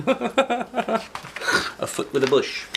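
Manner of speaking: slow, amused and high; laughing. Real, not acted. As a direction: savor the joke with a laugh. Amused repeat after laugh.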